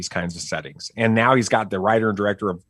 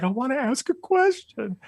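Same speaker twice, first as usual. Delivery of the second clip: put-on higher small-boy voice; shy kid voice. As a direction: play the timid son. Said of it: "And he's like".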